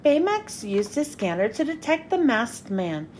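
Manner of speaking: usual speaking voice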